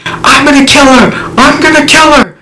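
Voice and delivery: full-volume, high-pitched enraged threat; yelling. Retold or imitated. Jealous husband yelling in front of the public, furious yell. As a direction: yell it across a parking lot. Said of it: "he is yelling".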